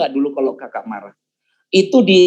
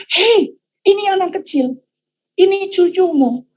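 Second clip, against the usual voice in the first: wife scolding; 13 semitones higher than usual. Retold or imitated. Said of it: "tiba-tiba Kak Ita tuh".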